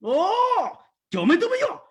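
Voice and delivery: high, slow, laughing mock line; hammed-up Chinese movie voice. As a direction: overplay a dramatic film cry. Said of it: "Mo be like".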